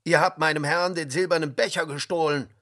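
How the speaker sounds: heated, forceful shouting in a story; angry. A narrator's reading. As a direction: call out the thieves angrily. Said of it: "rief er zornig".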